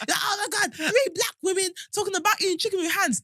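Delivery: high, shrieking outraged commenter; mock the scandalised viewer.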